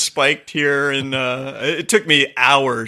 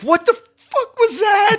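high, sharp frightened cry; startled shriek. Retold or imitated. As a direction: jolt awake shrieking in fright. Waking to a scream in fright.

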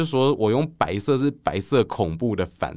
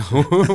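loud laugh; 5 semitones higher, 6 dB louder than usual. Real, not acted. Laugh after joke.

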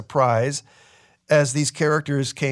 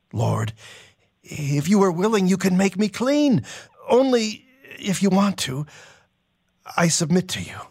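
pleading; 5 semitones higher than usual. Acted. Leper begs Jesus for healing.

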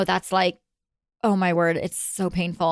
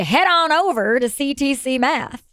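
higher, slow Oklahoma twang; Oklahoma accent; 5 semitones higher, slower than usual. Shown as a demonstration. Co-host correcting host's attempt at the sponsor line, slow Oklahoma accent. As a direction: model the Oklahoma accent with a drawn-out delivery. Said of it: "No, you'd say".

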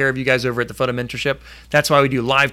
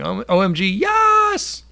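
excited; 8 semitones higher, slower than usual. Retold or imitated. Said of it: "She's saying".

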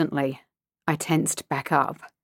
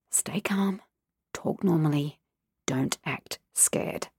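hushed inner voice; 2 semitones lower, 7 dB quieter than usual. Acted. Child's inner self-talk.

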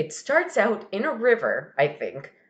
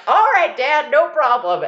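put-on loud, high child voice; agreeable little kid; 6 semitones higher, 7 dB louder than usual. Kid answering dad in the river, eager little voice. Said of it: "the little kid's like".

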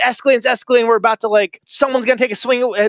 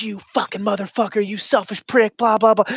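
retold insult; 4 semitones higher than usual. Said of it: "I'm like".

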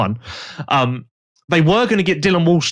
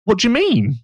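mildly baffled, rising at the end; halting mock bewilderment; retold dumbfounded; 4 semitones higher, slower than usual. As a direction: imitate a confused rider questioning the team. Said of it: "he was just dumbfounded".

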